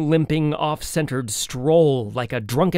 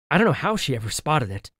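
usual speaking voice

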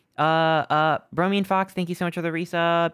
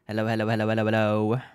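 lower, goofy dropped-voice hellos; deep silly hellos; 7 semitones lower, faster than usual. Pretend. Streamer greeting chat in a goofy lowered voice. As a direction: drop the voice way down for fun.

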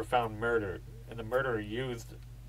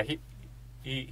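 ordinary delivery

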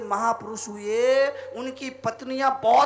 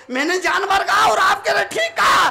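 high, loud wifely protest; hammed-up disbelief; acted incredulity; 8 semitones higher, 4 dB louder than usual. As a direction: question it in a raised voice. Wife protests husband's answer.

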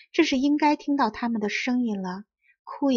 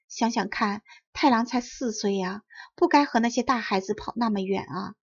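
ordinary delivery, nothing marked

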